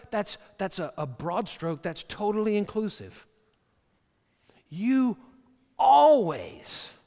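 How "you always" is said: always drawn out, loud and high; mock accusing spouse, always punched; stress on always. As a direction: hit always hard, accusingly. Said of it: "never say never or always".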